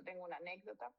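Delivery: usual speaking voice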